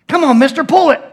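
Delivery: high, loud pestering; imitated impatient kid. Kid pushing the man to pull the lever, high loud imitation. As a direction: urge him on like a worked-up youngster. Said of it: "And the kid goes".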